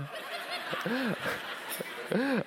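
laugh. Real, not acted. Laughs at crowd.

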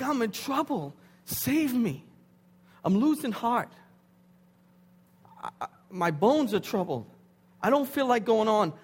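loud, high desperate plea; crying out for help. Acted. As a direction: cry out urgently for rescue. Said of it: "They look like cries".

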